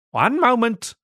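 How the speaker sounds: high called answer; calling out. A narrator's reading. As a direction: call it back over your shoulder. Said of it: "she called".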